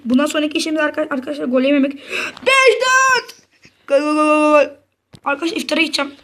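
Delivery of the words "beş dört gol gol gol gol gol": goal celebration. Real, not acted. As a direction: scream gol over and over. Scoring again.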